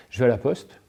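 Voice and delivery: ordinary delivery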